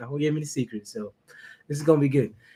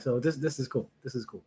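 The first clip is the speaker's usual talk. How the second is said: ordinary talk, nothing marked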